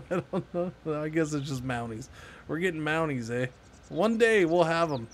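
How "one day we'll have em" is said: usual speaking voice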